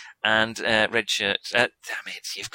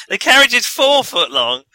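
much louder and higher, insisting; loud exasperated correction; 10 semitones higher, 12 dB louder than usual. Real, not acted. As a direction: shout the correction over everyone. GM correcting carriage length emphatically.

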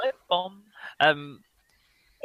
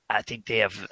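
usual speaking voice

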